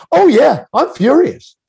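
high, loud mock fury; retold furious answer, high and loud; mad. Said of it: "she sounded mad".

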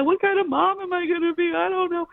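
hammed-up weepy whine, very high-pitched; tearful wailing. Pregnant wife melting down to her husband, mock tearful wail. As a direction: imitate your own high, sobbing meltdown. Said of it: "I start crying".

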